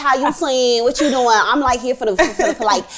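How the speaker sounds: hammed-up Southern American city-girl accent; bad Southern accent. Host mocking how they all talk, bad Southern accent. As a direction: badly imitate a country American accent. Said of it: "a la-, southern accent".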